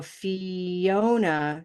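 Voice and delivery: Fiona drawn out very slowly; slowed-down Fiona; slow demo. Shown as a demonstration. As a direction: stretch Fiona to show the glide. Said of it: "she got a Y".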